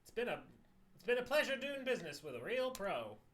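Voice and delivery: high, loud grovelling thanks; high, loud fawning trader voice; fawning native trader. Acted. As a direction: gush like a flattering merchant.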